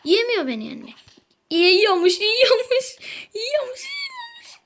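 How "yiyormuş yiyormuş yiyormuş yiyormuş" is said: rising higher and higher into a whiny wail; mocking whine. Real, not acted. Jeering at the rival player, a whiny rising wail. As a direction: repeat it mockingly, climbing to a cracked squeal. Mocking the opponent who keeps winning.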